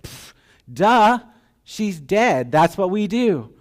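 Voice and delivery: high, loud scoffing duh; mocking scoff. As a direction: sneer it down at him. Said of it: "They're mocking him".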